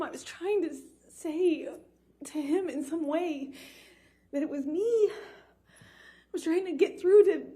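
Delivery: real tears, the voice breaking high and tight as she relives it; crying. Real, not acted. Survivor recounting her attacker's rage, real sobbing while speaking. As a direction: cry through the words, struggling to get them out.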